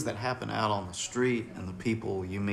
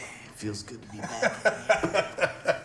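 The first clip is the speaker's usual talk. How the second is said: laughing; 2 semitones higher, slower than usual. Retold or imitated. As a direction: say it through a happy laugh. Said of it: "they come back and".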